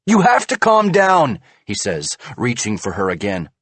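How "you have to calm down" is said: high, loud urgent plea. A narrator's reading. Husband urges wife to calm down, urgent. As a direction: try to calm her.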